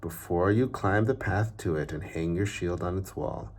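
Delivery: even, unhurried reading-aloud voice; storybook narration. Acted. Teacher reading a picture book to children, story narration. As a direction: tell the tale like a bedtime story.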